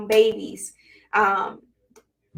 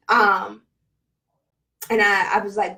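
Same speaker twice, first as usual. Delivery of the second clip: ordinary talk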